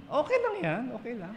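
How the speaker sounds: high, polite brush-off; reassuring host. Acted. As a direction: act out excusing an older guest's spill. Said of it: "pag yung bisita nating matanda".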